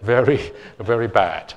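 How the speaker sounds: laughing through the shame. Real, not acted. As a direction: laugh it out, loud and embarrassed. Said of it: "it's very embarrassing".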